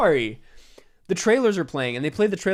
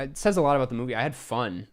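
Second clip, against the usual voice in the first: normal talking voice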